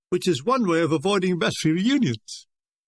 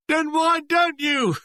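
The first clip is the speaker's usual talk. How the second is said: high, drawn-out jeer; heckler's retort; 8 semitones higher, slower than usual. A narrator's reading. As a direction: call it back from the audience. Heckler answers the tenor.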